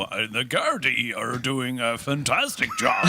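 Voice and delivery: slow, pompous minister announcement; mock a minister praising the Gardaí. Comedian mocking a minister's statement, slow and pompous. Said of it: "where David go".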